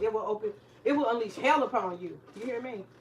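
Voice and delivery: usual speaking voice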